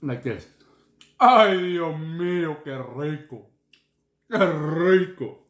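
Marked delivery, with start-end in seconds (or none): 1.2-3.5 savoring exclamation. Real, not acted. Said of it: "that means". 4.3-5.4 savoring exclamation. Real, not acted. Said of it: "that means".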